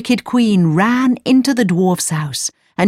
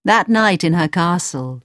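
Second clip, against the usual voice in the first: ordinary delivery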